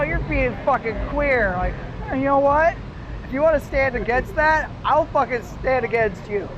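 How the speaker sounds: ordinary talk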